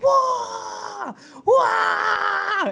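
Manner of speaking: high, full-throated "waah" scream; imitated fan scream. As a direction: scream like a rabid fan busting veins. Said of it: "started busting veins out of his head".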